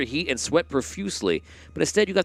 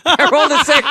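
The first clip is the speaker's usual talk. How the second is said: laughing; 13 semitones higher, 9 dB louder than usual. Real, not acted. Dice roll turns party to zombies.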